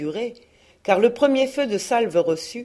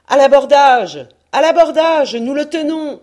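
higher, much louder shouted order to board; battle cry; 7 semitones higher, 10 dB louder than usual. Acted. Captain ordering his crew to board the ship, roaring battle cry. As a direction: roar the boarding call.

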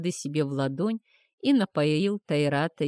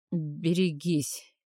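usual speaking voice

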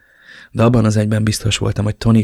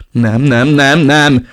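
rising into a loud anguished no; desperate cry; 5 semitones higher, 7 dB louder, much slower than usual. Acted. Father crying out no in despair on the street. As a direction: narrator breaking as his son vanishes.

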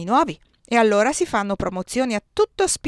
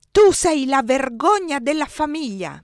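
slow, higher, louder accusation; yelling; 6 semitones higher, 6 dB louder, slower than usual. A narrator's reading. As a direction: hurl it at her across the table. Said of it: "mi urlò".

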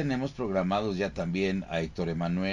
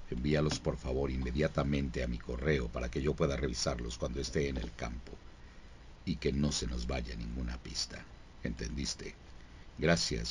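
low, soft serious agent briefing; stern detective giving orders; CSI investigator voice; 9 semitones lower, 7 dB quieter than usual. Acted. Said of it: "así empieza él".

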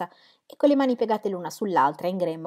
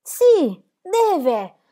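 sharply higher and much louder, furious; with anger; 11 semitones higher, 9 dB louder than usual. Acted. Will answering Dorotea with fury. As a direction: hurl it back at her in fury. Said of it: "disse Will con ira".

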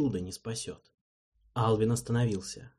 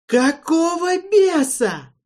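high, loud, slow curse; furious outburst; 10 dB louder, slower than usual. A narrator's reading. Alvin swears at Erald in fury. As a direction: hurl the curse out.

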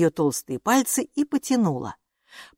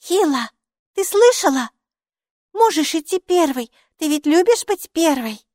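high, loud, happy little voice; gleeful high-pitched small creature; delighted; 11 semitones higher, 5 dB louder than usual. A narrator's reading. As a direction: chirp the good news gladly. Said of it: "обрадовалась Майки".

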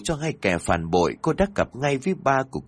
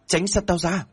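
much higher, shrieked get away; screaming; 9 semitones higher than usual. A narrator's reading. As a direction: scream them away in desperation. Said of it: "cô liền hét lên". (thét lên đuổi đi)

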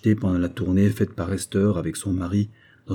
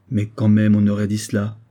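usual speaking voice

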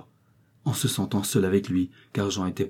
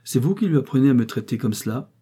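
raised, mildly indignant question; louder, annoyed visitor; brusque; 5 semitones higher, 4 dB louder than usual. A narrator's reading. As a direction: challenge the mother abruptly. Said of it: "dit brusquement Bénard en se levant".

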